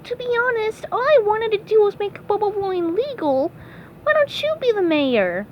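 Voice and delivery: high cartoon voice; easygoing SpongeBob. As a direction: shrug it off in a high pitch.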